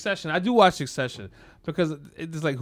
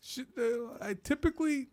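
high, soft, halting protest; mock restrained indignation; 6 semitones higher, 4 dB quieter than usual. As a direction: swallow the rage and answer very politely. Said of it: "I'd be like".

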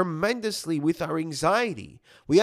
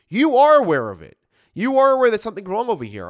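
louder, pressing hard on ARE; emphatic insistence; 4 semitones higher, 9 dB louder than usual. Real, not acted. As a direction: insist they really are aware. Urging listeners they are aware.

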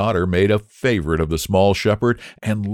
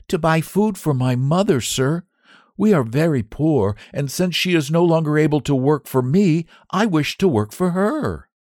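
put-on high, earnest child's voice; a poor boy explaining his need; little boy pleading; 9 semitones higher than usual. Boy telling the squire about his mother, earnest.

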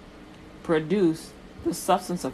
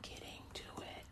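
whisper; 24 dB quieter than usual. Real, not acted. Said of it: "what does that have to do".

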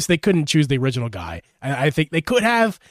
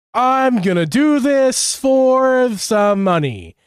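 lazy; 7 semitones higher, much slower than usual. Retold or imitated. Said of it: "it sounds lazy".

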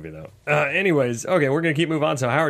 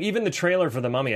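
usual speaking voice

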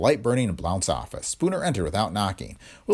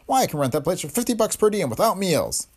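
outraged; 11 semitones higher than usual. A narrator's reading. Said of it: "Blount continued in outraged accents".

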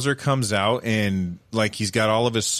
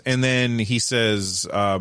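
ordinary talk

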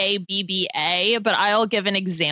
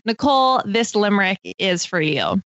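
normal talking voice

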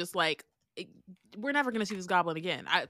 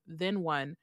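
ordinary talk

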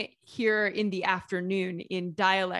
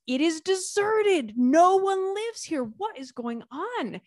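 high, slow disbelief at empty streets; retelling the shock of finding streets deserted, much higher and slow; incredulous; 11 semitones higher, 4 dB louder, slower than usual. Retold or imitated. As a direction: act out stunned confusion, much higher pitch. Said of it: "And we're like".

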